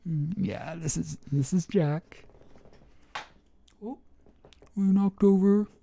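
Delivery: high, squeaky voice for the dog; doggy voice. Acted. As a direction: talk as if the little dog is speaking. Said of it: "Excuse the doggy voice".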